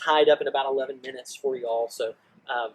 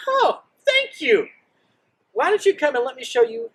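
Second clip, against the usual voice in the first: fawning welcome; 13 semitones higher, 6 dB louder than usual. Retold or imitated. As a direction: gush over the guests, showing off. Hezekiah welcomes Babylon's envoys.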